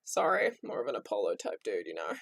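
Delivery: teasing voice telling him he's no Zeus. Retold or imitated. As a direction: drop low for a cheeky put-down. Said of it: "and I was like".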